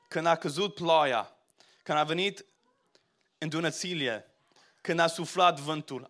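Romanian lyrics read aloud as a testimony, swelling at the end. Shown as a demonstration. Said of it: "read it in Romanian".

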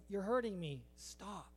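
low, soft plea; small, subdued protest; hurting sword. Acted. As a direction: plead quietly as the suffering sword. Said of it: "if the sword could talk, it would say".

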